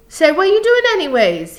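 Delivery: high, loud alarmed challenge; crying out. A narrator's reading. Lenny challenging Crooks over George, crying out. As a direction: cry out in protest, pitch way up. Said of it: "he cried".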